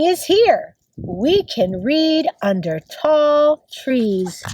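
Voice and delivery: slow, measured picture-book reading; reading to children. Acted. Teacher reads a spring book to kids, slow read-aloud. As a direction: read slowly, each word spaced out for young children.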